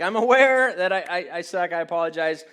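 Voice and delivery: loud, high outburst easing down into apology; hammed-up exasperated sitcom yell; George Costanza. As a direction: holler the admission, then come down a little. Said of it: "in my best George Costanza voice".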